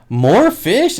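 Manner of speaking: high, mock-surprised clerk. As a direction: play the clerk's amused surprise.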